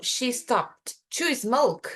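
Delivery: slow, deliberate stress on "to"; slow example sentence. Shown as a demonstration. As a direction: lean on "to", slowly. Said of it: "se eu falo".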